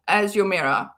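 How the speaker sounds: ordinary talk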